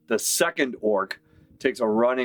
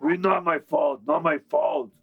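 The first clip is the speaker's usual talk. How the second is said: higher, defensive dopey orc; 7 semitones higher than usual. Acted. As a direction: protest like a sulky brute. Orc repeating his defence.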